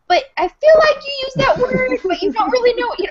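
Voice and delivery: high, whiny mock complaint; mock whiny reader. A reader complains to the apologizer in a high whiny voice. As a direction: squeak it out like a hurt fan. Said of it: "you're like".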